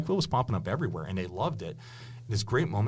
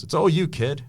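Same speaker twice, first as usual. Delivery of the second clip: put-on higher, louder old-time radio announcer delivery; 8 semitones higher, 9 dB louder than usual. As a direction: punch it out like a 1930s radio man. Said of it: "Like the radio voice? / Yeah, the radio voice".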